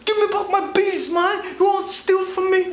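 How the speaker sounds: a hustler blowing up at the tourist; hammed-up shrieking Italian vendor tirade; angry vendor. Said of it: "he's like".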